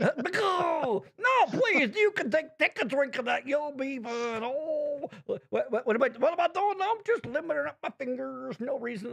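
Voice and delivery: put-on high, singsong sly old man voice; Bill Cosby impression. Playing Cosby to tease his cohost, high and sly. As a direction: play a coy Cosby, sweet and innocent. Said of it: "dangerously close to Cosby".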